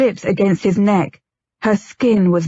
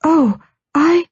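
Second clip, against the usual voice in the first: high, sudden startled cry; 9 semitones higher than usual. A narrator's reading. Wife seeing her husband's bare chest, in a high startled cry. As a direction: gasp out in surprise.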